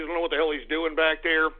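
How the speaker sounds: ordinary talk, nothing marked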